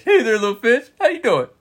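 high-pitched greeting to a fish; silly, high baby-talk voice; pet-talk voice. Acted. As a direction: coo hello at a tiny pet. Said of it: "when we talk in that voice".

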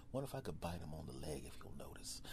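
low, fast whisper; secret thoughts. Acted. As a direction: whisper a sneaky plan.

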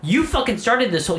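high-pitched replayed accusation; indignant accusation. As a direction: blame them back, exasperated. Retold quarrel, blaming back.

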